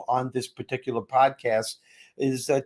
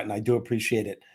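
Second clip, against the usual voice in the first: normal talking voice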